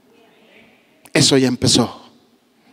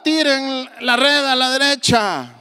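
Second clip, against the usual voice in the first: shouted call; 7 semitones higher than usual. Retold or imitated. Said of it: "Y les grita".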